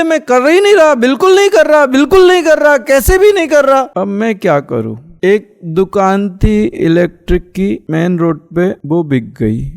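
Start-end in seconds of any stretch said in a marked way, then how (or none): 0.0-3.8 high-pitched, hurried protesting denial; emphatic denial. Retold or imitated. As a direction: act out someone protesting his innocence, pitch jumping up. Acted denial by the accused man. (ऊँची आवाज़ में ज़ोरदार इनकार)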